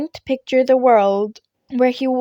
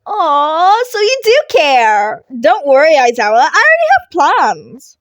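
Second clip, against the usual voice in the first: put-on higher, louder, gleeful teasing kid; teasing Midoriya; 5 semitones higher, 8 dB louder than usual. Midoriya teases his teacher for caring, gleeful. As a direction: rib him for caring, gleefully.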